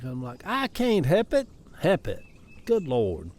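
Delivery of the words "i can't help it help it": "help" slips to hep, no L, pitched up; "can't help it" as a Southern "cain't hep it"; higher-pitched self-mimicry, dropping the L in "help"; old dialect "hep it". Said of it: "I revert back to old ways of dialect".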